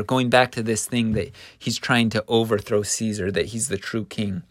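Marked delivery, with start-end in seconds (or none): none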